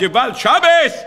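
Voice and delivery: high, drawn-out cry of alarm; retold loud cry. Chafetz Chaim protesting a Shabbat violation, retold outcry. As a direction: cry it out slowly, pitched well up. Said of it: "צעק בקולי קולות".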